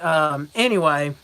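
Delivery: ordinary delivery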